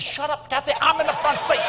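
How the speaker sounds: high-pitched panicked plea; comic panic. Retold or imitated. Front-seat passenger telling his wife to shut up, comic panic. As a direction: hush her in a rising panic.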